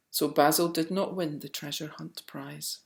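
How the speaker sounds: plain reading voice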